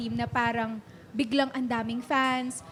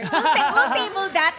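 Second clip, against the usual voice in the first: laughing; 3 semitones higher, 7 dB louder than usual. Real, not acted. Laughing, pulls back from topic.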